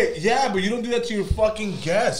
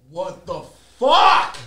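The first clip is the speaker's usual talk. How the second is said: slow stretched yell of pain; pained shout; 2 semitones higher, 8 dB louder, much slower than usual. Real, not acted. Guest yelling at host as spice burns. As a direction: cry out as the heat hits. Burning from hot chip.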